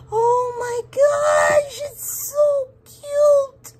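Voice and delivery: gasp, then very high, loud, drawn-out gushing; real, loud high-pitched delight; delighted squeal. Real, not acted. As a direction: squeal slowly over something adorable. Thrilled as the mold comes out.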